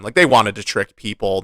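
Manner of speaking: ordinary talk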